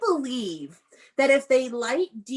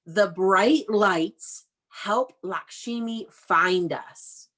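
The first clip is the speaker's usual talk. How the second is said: slow read-aloud; 2 semitones lower, much slower than usual. Shown as a demonstration. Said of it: "reading slowly to children".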